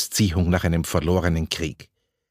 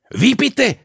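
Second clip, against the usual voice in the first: very high, louder startled question; stammered disbelief; 5 dB louder than usual. A narrator's reading. Club member reacting to the surprise venue, stammered disbelief. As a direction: blurt it out, aghast and high-pitched. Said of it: "stotterte Peter Überhitzer".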